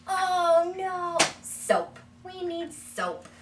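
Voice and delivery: very high, loud "Oh, no, soap"; mock surprise. Shown as a demonstration. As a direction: pretend alarm for the toddler. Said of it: "wash him with a toy".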